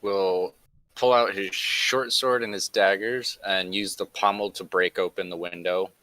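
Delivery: normal talking voice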